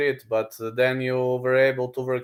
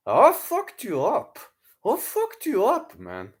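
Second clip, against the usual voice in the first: high, slow, put-on taunting voice; mock the fighter's boast; imitating a boastful yell; 7 semitones higher than usual. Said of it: "he was yelling".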